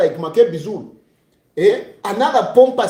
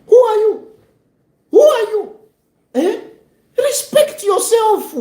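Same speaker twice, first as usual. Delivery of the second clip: indignant rebuke; 11 semitones higher than usual. Real, not acted. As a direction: put him in his place. Scolding the jailed politician again.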